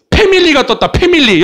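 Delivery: high, loud "패밀리" with a plain P; P instead of F. Shown as a demonstration. Teacher mocking the show title's mistake, high "패밀리" with P. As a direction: pitch it up and hit the P in "패밀리". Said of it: "P로".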